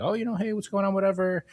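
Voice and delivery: high-pitched casual phone greeting; imitated small talk. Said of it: "We make small talk".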